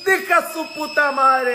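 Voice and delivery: slow, high drawn-out yell. Real, not acted. Yelling at the squealing coffee maker, slow and high. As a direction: stretch the curse out high and slow.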